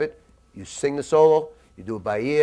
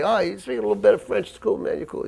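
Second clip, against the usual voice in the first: friendly waiter; 6 semitones higher than usual. Retold or imitated. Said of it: "and they say".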